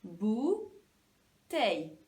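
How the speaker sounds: "Bouteille" slow, as bou, teille; syllable by syllable. Shown as a demonstration. Teacher doing the same again with pupils, syllable by syllable. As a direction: split "Bouteille" with a clear gap. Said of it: "on fait pareil".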